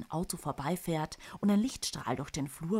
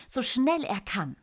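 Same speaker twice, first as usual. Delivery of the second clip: plain reading voice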